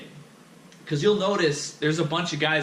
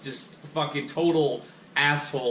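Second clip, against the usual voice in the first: usual speaking voice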